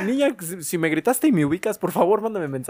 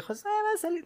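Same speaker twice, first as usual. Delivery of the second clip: mock call from outside; 9 dB quieter than usual. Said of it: "que me gritaran de".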